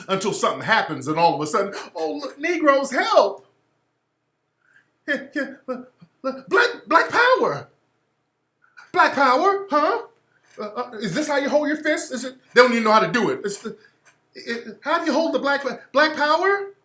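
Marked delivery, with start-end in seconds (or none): 1.9-3.5 high, louder hammed-up cry for help; sudden fake panic, much higher; mock plea for help. 5.1-16.7 much higher, fumbling mock enthusiasm.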